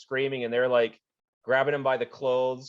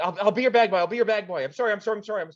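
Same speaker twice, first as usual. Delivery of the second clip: high, loud frantic pleading; horrified begging; 7 semitones higher, 4 dB louder, faster than usual. Acted. Annie begging the players to save him, horrified. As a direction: beg for mercy. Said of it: "all of a sudden horrified".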